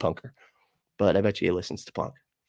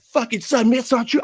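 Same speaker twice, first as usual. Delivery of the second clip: much higher, strained mock rant; mock angry chatter; 13 semitones higher, 4 dB louder than usual. Mocking the chat's hair-splitting atheists, shrill mock rant. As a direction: parody an outraged atheist in chat.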